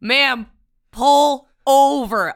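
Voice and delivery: mock cop on the loudspeaker. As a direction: drawl it out like a police megaphone. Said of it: "they go over the loudspeaker".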